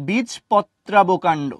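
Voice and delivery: বীজপত্রাবকাণ্ড drawn out very slowly; slow term. Shown as a demonstration. Teacher to students, slow drilled term. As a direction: stretch বীজপত্রাবকাণ্ড syllable by syllable. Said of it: "নামটা খুবই দুষ্টু".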